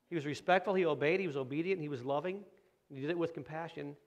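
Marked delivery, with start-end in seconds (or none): none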